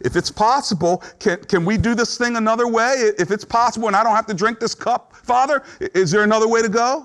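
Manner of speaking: high, pleading prayer; lightly voiced pleading prayer; Jesus bargaining. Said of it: "Jesus says".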